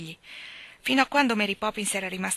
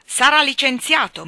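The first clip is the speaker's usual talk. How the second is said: loud, higher furious threat; threatening; 7 semitones higher, 12 dB louder than usual. A narrator's reading. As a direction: bark the verdict. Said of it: "minacciò il signor Banks". (minaccia urlata)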